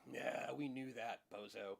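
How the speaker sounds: slow, sneering know-it-all; mock scoffing audience. Audience mocking the magician, slow scoff. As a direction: drag it out like a bored heckler. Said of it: "and your audience goes".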